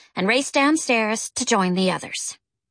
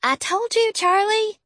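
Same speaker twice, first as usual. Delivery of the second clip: put-on older sister voice; 12 semitones higher than usual. A narrator's reading. Jackie, big sister, explains.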